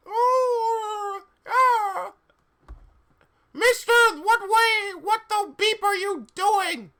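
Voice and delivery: very high, loud screech; angry shout. Acted. Rumbridge scolding a student, angry high shout. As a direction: shriek it in a hammed-up, furious high voice. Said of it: "Rumbridge shouted angrily".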